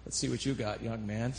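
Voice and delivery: low, quiet, unimpressed challenge; softly skeptical elder; stoic sceptic. Retold or imitated. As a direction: size them up coolly, keeping it low. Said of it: "they're real stoic".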